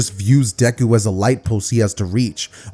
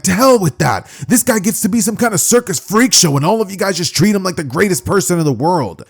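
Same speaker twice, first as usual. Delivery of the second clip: higher, indignant complaint; acted angry rant; 8 semitones higher than usual. Bakugo complaining to the teacher about Deku, angry. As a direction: rant back in a raised pitch.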